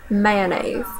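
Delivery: "mayonnaise" as "man-aise"; Southern "mayonnaise". Shown as a demonstration. Speaker demos the Southern "mayonnaise" as "man-aise". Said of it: "second will be Southern version".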